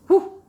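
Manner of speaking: high pitched exhale of relief; high sharp whew. Real, not acted. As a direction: blow out a loud high whew. Recovering from boiling tea.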